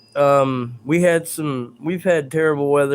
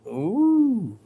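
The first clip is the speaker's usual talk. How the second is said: teasing ooh; 6 semitones higher than usual. Real, not acted. Streamer teases two chatters hitting it off. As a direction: tease the lovebirds.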